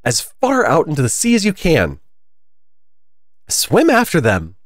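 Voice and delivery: high, loud urging cry; the Gryphon urging wildly, high and loud; excited scream. A narrator's reading. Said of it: "screamed the Gryphon".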